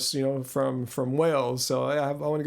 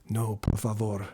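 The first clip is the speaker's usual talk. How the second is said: low Spanish accent, close to the mic; Antonio Banderas; 6 semitones lower than usual. Shown as a demonstration. Streamer retrying up close to the mic, low Spanish accent. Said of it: "I'll get up close to the mic".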